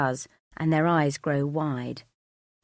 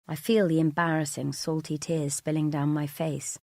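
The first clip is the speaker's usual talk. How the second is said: usual speaking voice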